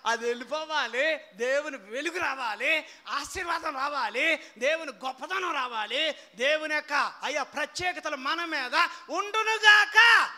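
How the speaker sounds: loud rising proclamation; preaching blessing. Acted. Preacher pronounces blessings over the congregation, loud.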